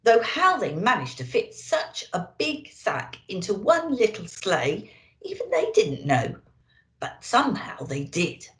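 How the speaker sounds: usual speaking voice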